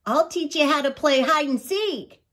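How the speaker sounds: high, eager little badger; cheerful young animal voice; happy young badger. A narrator's reading.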